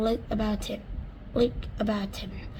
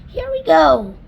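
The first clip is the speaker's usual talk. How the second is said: loud high call; shout; 6 semitones higher, 11 dB louder than usual. Acted. Creature calling out as the room lurches, loud.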